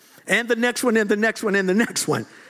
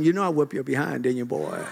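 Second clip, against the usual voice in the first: playful taunt; 9 semitones lower, 4 dB quieter than usual. Retold or imitated. Teasing old friend.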